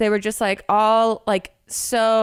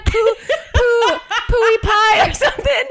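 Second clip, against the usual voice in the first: laughing; 10 semitones higher, 3 dB louder than usual. Real, not acted. Quoting drunk table, laughing.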